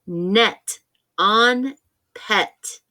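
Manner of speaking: net on pet drawn out very slowly; slow stretched phrase. Shown as a demonstration. Teacher slowly modelling net on pet to the class. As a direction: stretch every sound apart. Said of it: "Listen to the phrase".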